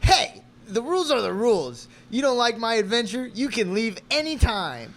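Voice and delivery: loud, high, domineering retort; angry game master. Acted. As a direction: lay down the law loudly. Acted quarrel, game master retorts.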